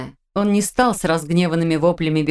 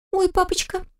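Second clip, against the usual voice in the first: put-on much higher, giggly teenage girl; girlish voice; 13 semitones higher than usual. A narrator's reading. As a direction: tease it like a mischievous daughter. Daughter caught out.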